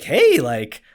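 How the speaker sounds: cheery greeting. Retold or imitated. Greeting the recovered patient at the scanner, loud and cheery. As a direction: greet her like a delighted old friend. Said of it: "I'm like".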